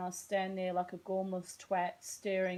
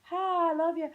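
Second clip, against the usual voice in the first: gushing greeting; 9 semitones higher, 5 dB louder than usual. Real, not acted. Greeting friend Terry.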